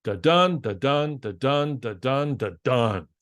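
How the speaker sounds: da-dun chanted, the dun stressed each time; iambic rhythm. Shown as a demonstration. Showing iambic pentameter to listeners, stressed beats. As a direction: tap out the beat, weak then strong. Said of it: "For example".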